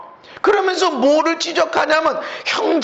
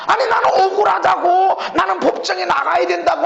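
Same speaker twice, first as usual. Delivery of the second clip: aggrieved complaint; 5 semitones higher than usual. Retold or imitated. Pastor acting a member who claims he was wronged, aggrieved plea. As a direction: whine that you've been treated unfairly.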